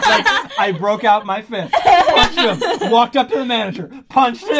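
loud, high, cracking up; hammed-up triumphant retelling, shouted with laughs; laughing mock boast. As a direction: play the imagined hero through laughter. Comic imagined hero fantasy, laughing.

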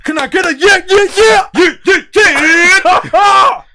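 shouted hype call, pitch way up; rally the crowd loudly. Pretend. Rallying his friend to cheer along.

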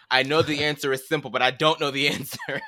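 loud, high frustrated wail; hammed-up frustrated outburst; mock exasperation. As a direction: play up being annoyed at yourself, laughing through it. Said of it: "that one pissed me off".

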